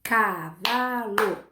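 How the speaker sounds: "Cavalo" very slowly as ca, va, lo; three drawn-out beats in "Cavalo"; syllable by syllable. Shown as a demonstration. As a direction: stretch and split "Cavalo" for counting. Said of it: "Quantas sílabas tem a palavra".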